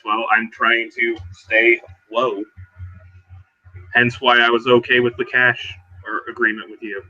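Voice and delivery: ordinary delivery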